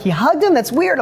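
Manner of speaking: put-on high-pitched, incredulous child's reaction; baffled kid. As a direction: play a son puzzled by the story. Acts out son's baffled reaction.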